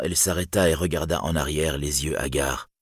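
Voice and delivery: ordinary delivery, nothing marked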